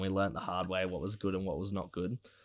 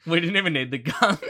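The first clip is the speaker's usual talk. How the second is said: laughing; 10 semitones higher, 10 dB louder than usual. Real, not acted. Dark joke, laughing.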